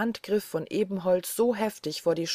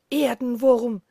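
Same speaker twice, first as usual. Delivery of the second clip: raised, louder cry of contempt; thundering call; 6 semitones higher, 6 dB louder than usual. A narrator's reading. Forest spirit to cowering Peter, thundering. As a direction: hurl it out like a thunderclap, higher than narration. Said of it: "rief er mit einer Stimme, die wie Donner grollte".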